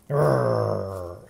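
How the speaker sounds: low rolling playful bear growl. Pretend. Bear in picture book.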